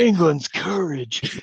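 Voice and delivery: put-on slow, drawled American-accented hero line; mock American accent. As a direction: parody the actor's American accent, dragging it out. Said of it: "already from his accent".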